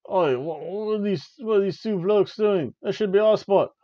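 loud, high hammed-up Australian lads, chanting at the end; the jobber team mocking the Street Profits in a loud mock Australian accent. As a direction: shout it like rowdy Aussie fans in a mock Australian accent. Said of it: "They're like".

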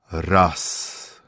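deep tolling bell voice; booming bell. A narrator's reading. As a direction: boom it out like a great bell. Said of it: "zawołał dzwon".

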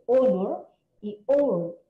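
"honor" and "our" drawn out slowly with no h sound; slow teacher demo of "honor" and "our" with silent h. Shown as a demonstration. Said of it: "en la pronunciación es air".

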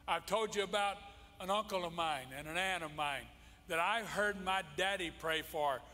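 ordinary delivery

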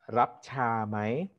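slow, careful Thai phrase, "Rap cha mai?" drawn out; slow Thai demo. Shown as a demonstration. Teaching a guest the Thai offer of tea, slowly. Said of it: "So you say it when you want something".

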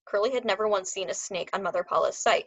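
ordinary delivery, nothing marked

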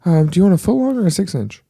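put-on bored teen cashier. Bored teen Subway employee.

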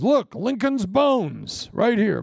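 put-on slow, high kid voice; son's voice. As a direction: draw it out like an excited kid. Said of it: "My son Grant goes".

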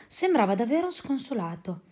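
plain reading voice

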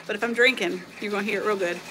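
usual speaking voice

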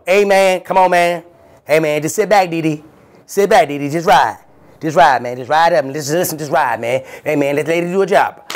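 high, loud friend begging him to sit back; pleading buddy voice. Retold or imitated. Friend Billo telling Dee Dee to just ride, high and loud. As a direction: soothe a hothead, but loudly.

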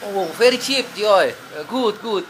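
loud, high and delighted; excited. Real, not acted. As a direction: gush over the bargain. Still delighted by the bargain.

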